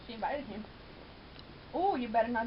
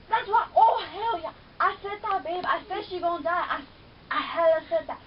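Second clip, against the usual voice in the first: loud, higher, I-told-you-so; gloating excitement; 3 semitones higher, 7 dB louder than usual. Real, not acted. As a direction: crow about being right. Triumphant I-said-that.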